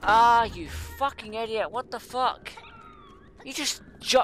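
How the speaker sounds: furious at the screen; angry yell. Real, not acted. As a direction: let the frustration explode. Yelling at game character again.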